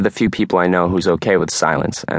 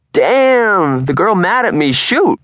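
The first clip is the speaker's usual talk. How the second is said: mock hurt holler; 12 semitones higher, 5 dB louder, much slower than usual. Quarterback jokes after being yelled at.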